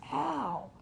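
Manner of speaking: high, falling yelp; pained "ow". Acted. Boy having the pellet squeezed out, yelping.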